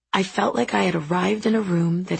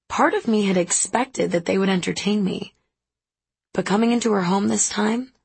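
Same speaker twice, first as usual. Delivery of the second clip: usual speaking voice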